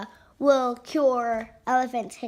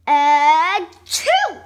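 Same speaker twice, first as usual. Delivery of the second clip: loud sneeze; 7 dB louder than usual. Acted. Elephant sneezes.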